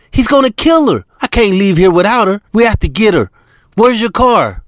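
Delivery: put-on high, agitated young woman's voice; China fearing for her friend; China worked up. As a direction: pour it out, then panic about Camilla. China venting then panicking.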